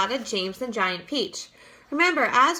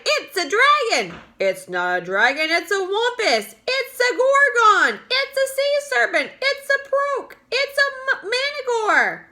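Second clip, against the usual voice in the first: much higher, loud panicked crowd cries; shouting; 12 semitones higher, 5 dB louder than usual. A narrator's reading. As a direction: shout over each other in alarm. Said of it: "all started shouting at once".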